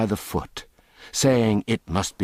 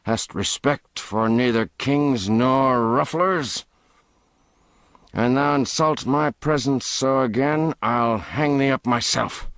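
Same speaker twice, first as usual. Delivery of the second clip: stern rebuke; 6 semitones higher than usual. Acted. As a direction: put him in his place. Ruffler rebukes Hobbs.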